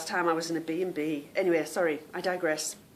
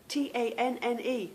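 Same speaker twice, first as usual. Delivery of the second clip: ordinary talk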